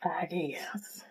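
breathy whispered aside; whispering. Retold or imitated. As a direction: whisper it to yourself. Repeats her own quote softly.

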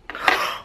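sharp excited intake of breath; stunned gasp. Real, not acted. Collector opens the mystery box, stunned gasp. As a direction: gasp at a lucky find. Sees the wanted mystery pop.

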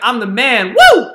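a smug boast, then a very high, loud whoop; hammed-up whoop waving the agreement; mock triumph. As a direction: cheer like a fool who thinks he won. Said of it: "waving around the agreement".

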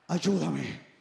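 low, pitiful plea for help; a hint of a helpless father begging; mock victim plea. Father begging his son for help, lightly voiced victim. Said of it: "Pone voz de víctima".

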